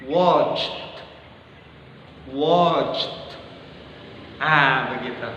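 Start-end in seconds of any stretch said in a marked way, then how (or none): 0.1-3.4 "Watched" with a light t released after the ch. Shown as a demonstration. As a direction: repeat "Watched", t lightly popped. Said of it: "Letupkan saja T". ("Watched" dengan T diletupkan)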